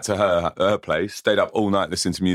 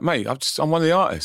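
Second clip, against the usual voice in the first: indignant comeback, pitched much higher; retold indignant protest; 11 semitones higher than usual. Performer arguing with a fella over a lift, retold indignant protest. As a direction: protest in a high voice, retelling it.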